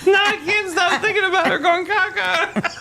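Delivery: high squealing laughter; hysterical laugh. Real, not acted. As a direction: laugh helplessly through the words. Laughing fit again.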